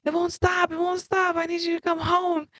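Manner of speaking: high, frantic mock scream without the volume; retold panicked scream. Telling of calling husband about the pot fire, mock screaming. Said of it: "And I'm screaming".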